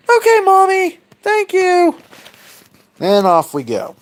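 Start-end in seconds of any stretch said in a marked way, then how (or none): 0.1-2.0 put-on very high, louder child voice; squeaky little boy voice; little kid voice. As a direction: answer like an eager tiny child. Little Kronos answering his mother.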